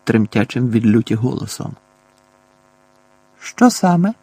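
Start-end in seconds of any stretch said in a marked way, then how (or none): 3.4-4.1 higher-pitched, coolly questioning reply; a touch of coolness in a raised pitch; cold reply. A narrator's reading. Boy answers his furious uncle, coldly. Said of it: "холодно озвався Гаррі".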